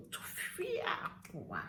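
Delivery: soft, high, very slow Tufiakwa; demonstrated exclamation. Shown as a demonstration. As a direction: draw out Tufiakwa high and quiet. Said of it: "Look at me".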